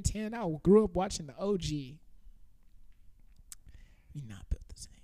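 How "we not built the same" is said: soft unvoiced whisper. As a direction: whisper it like a secret. Repeats the boast in a whisper.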